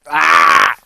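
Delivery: loud, drawn-out, strained cry; strained Luigi yell. Retold or imitated. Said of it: "I can't do it".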